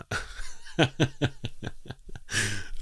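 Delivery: a quiet, airy burst of laughter; breathy laugh. Real, not acted. Host cracking up at the list, quiet laugh.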